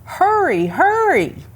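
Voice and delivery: high urgent call of a grandmother. Acted. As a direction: call him home in a high voice. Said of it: "she called".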